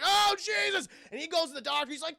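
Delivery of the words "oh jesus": high, loud horrified cry; horrified shout. Retold or imitated. Chad sees the old man's face, shouting in horror. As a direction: recoil and shout it.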